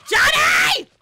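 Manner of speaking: very high, very loud yell of the name; cheering Johnny with a scream. Real, not acted. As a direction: shriek his name. Cheering teammate on.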